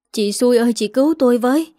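mild tearful pleading, pitch rising toward the end; a hint of sobbing, begging for help; sobbing plea. A narrator's reading. Nga begs her in-law to save her, mildly tearful. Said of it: "bà Nga òa khóc nức nở".

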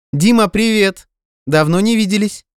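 high-pitched, delighted greeting; joyful exclamation. A narrator's reading. Girl at a restaurant table greeting Dima, joyful exclamation. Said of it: "радостное восклицание одной из них".

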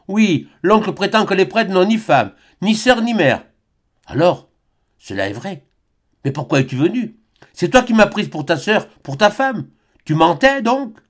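high-voiced young woman pressing him; hurt disbelief, rising to accusation; Albine, hurt. A narrator's reading. Albine confronting the priest.

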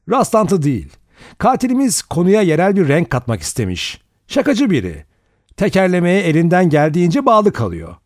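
high, tense delivery; high-pitched voice. A narrator's reading. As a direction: let the voice rise high, nerves giving way. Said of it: "böyle tiz çıkıyordu sesi".

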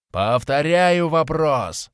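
loud, slow, shouted in the face; shouting. A narrator's reading. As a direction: yell it slowly and loudly. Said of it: "прокричал в лицо орк".

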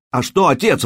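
выше, громко, с возмущением; вскинься, удивлённо возмутись; воскликнул. A narrator's reading. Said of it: "воскликнул Карш". (возмущённое восклицание)

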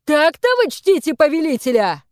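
high, loud indignant outburst; exasperated exclamation from an angered lord; angry rebuke. A narrator's reading. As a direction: scold them in outrage. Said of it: "вконец рассердившийся молодой господин Ли".

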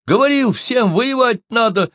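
high, loud, hurt outburst; indignant cry of reproach; offended shout. A narrator's reading. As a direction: yell it out feeling betrayed. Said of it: "с обидой закричал".

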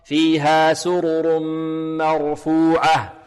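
slow chant, مرفوعة with a true open ma; pure open fatha. Shown as a demonstration. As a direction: recite مرفوعة drawn out without tilting the a. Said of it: "نحذر من تقليل فتحة الميم".

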